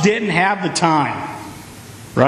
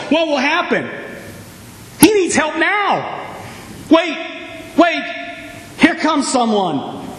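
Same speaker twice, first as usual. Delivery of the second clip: movie suspense; 6 semitones higher than usual. Acted.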